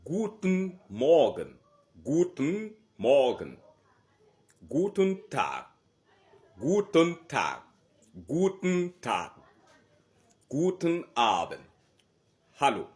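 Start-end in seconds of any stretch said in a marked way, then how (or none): 0.0-11.6 loud, high and slow, falling on each greeting; teacher models each German greeting loudly for the class. Shown as a demonstration. German teacher drills greetings, loud and falling. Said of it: "Wir sagen die Begrüßung".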